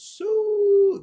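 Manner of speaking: high, loud celebration yell stretched out; Ronaldo goal shout. Retold or imitated. Son's goal celebration cry for the crowd, loud and high. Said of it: "like this loud".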